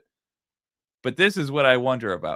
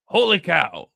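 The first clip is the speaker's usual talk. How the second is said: loud, higher mock shark exclamation, a bit overdone; imitating the shark's catchphrase; 5 semitones higher, 6 dB louder, slower than usual. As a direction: belt it out the way the cartoon shark does. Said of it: "when you have him go".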